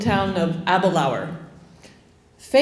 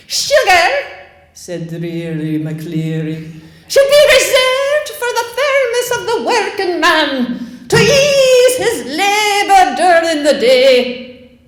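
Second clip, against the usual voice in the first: much higher, loud, slow public proclamation; pompous sheriff proclaiming; 11 semitones higher, 7 dB louder than usual. Retold or imitated. Sheriff proclaiming rationing rules to the town square, loud and pompous. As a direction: declaim to the whole square. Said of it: "hearing him as a girl in the public square".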